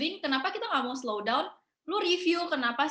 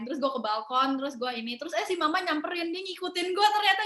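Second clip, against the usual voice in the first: normal talking voice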